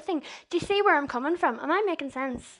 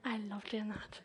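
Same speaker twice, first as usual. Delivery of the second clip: a real whisper, half to herself; whispered aside; 3 semitones lower, 13 dB quieter than usual. Real, not acted. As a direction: drop to a breathy private aside. Aside right after shouting amen.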